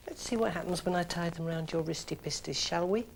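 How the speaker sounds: put-on soft, babyish coo; teasing. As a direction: sweet-talk him, sly.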